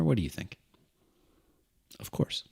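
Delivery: normal talking voice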